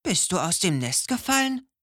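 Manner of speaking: a curious hedgehog checks on someone; hedgehog asking. A narrator's reading. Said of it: "fragte der Igel".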